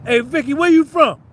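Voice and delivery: ordinary talk, nothing marked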